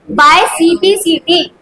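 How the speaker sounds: By CPCT dictated slowly, letter by letter; slow dictation for copying. Shown as a demonstration. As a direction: say it slowly so they can write it down. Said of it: "हमें क्या लिखना पड़ेगा?".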